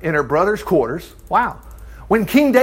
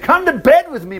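high-pitched insistent demand, read in narration; 8 semitones higher than usual. A narrator's reading. Brother grabs his half-sister in the story, demanding. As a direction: press it as a demand, mildly acted. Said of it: "He grabbed her and demanded".

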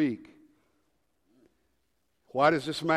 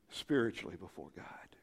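whisper; 8 semitones lower, 10 dB quieter than usual. Acted. Solemn hush before God.

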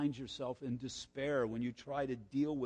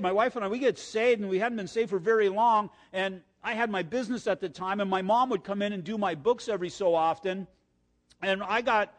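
normal talking voice

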